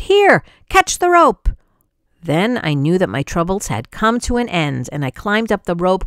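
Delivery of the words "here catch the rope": high, loud shout from above; shouted call. A narrator's reading. Rescuer shouting down to the boy, high and loud. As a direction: yell it down to someone in the water. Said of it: "someone up high shouted".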